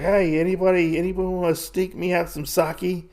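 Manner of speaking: a high, half-hearted shout calling across the camp; yelling. Retold or imitated. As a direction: call out for sake like a bored drunk guard. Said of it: "he's yelling at this moment".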